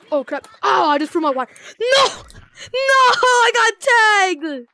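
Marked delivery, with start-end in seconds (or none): 1.8-4.7 high drawn-out wail of defeat; dismayed cry. Real, not acted. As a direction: stretch it out in disbelief. Just got tagged in game.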